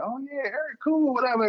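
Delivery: high, breezy brush-off; mock dismissive guy. Imitating a guy brushing off his wife's ex, high and airy. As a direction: toss it off like you don't care. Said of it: "don't be that guy where you like".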